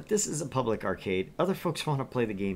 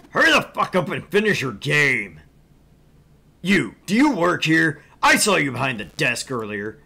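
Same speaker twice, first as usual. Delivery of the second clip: loud, furious demanding; angry customer; 4 semitones higher, 9 dB louder than usual. Acted. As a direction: yell like a rude customer. Angry customer yelling at attendant.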